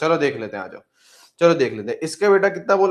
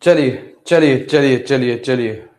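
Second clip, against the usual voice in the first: loud call to gather the class; 3 semitones lower, 8 dB louder, slower than usual. Real, not acted. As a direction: call everyone in, loudly. Teacher calls class to start. (ज़ोर से पुकारते हुए)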